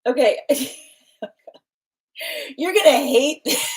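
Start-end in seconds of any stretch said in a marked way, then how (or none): 2.6-3.6 giggling through the words; can barely get it out for laughing. Real, not acted. Laughing as she warns the viewer. Laughing warning before reading.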